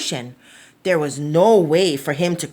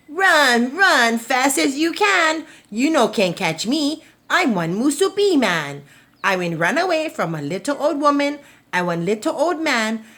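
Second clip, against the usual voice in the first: cheeky taunt; 7 semitones higher than usual. Acted. Musubi man taunting the poi dog, high singsong. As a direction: gloat while running off. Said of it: "only laughed".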